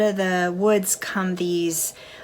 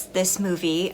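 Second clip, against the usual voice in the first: ordinary delivery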